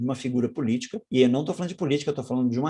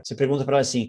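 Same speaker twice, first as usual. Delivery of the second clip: usual speaking voice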